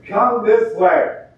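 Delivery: friendly calling. Retold or imitated. Church members calling a lost person toward the light. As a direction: wave them down the hall. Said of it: "people walking down the hall going".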